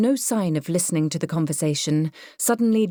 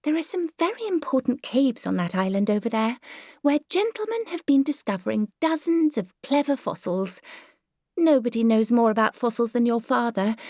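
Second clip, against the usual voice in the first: higher, genteel lady fretting about the drawing room; fussy Myrtle voice; 7 semitones higher than usual. Acted. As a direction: play the prim, self-satisfied mother. Myrtle explains to son.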